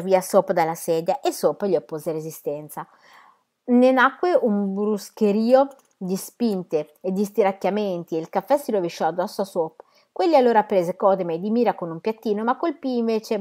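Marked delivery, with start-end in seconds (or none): none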